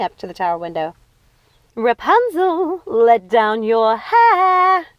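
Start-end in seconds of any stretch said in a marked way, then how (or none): 1.7-4.9 high, very slow sing-song call; drawn-out syrupy sing-song, higher; strange sing-song voice. Acted. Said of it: "that strange sing-song voice".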